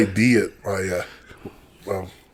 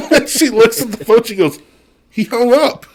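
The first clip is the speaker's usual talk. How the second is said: high, laughing through the words; 12 semitones higher, 3 dB louder than usual. Retold or imitated. Dad retelling his daughter's prank call, laughing. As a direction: giggle out the punchline.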